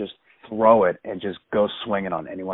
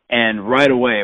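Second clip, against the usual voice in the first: ordinary talk, nothing marked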